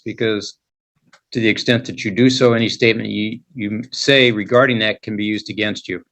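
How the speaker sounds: ordinary talk, nothing marked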